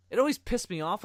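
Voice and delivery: usual speaking voice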